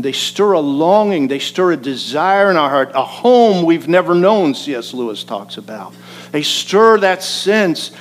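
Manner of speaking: loud, building preacher's swell; impassioned preaching. Real, not acted. Preacher to congregation on what melancholy melodies stir, impassioned. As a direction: preach it with rising force and longing.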